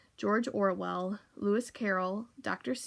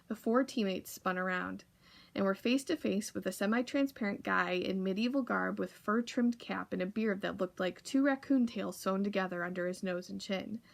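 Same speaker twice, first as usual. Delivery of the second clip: ordinary delivery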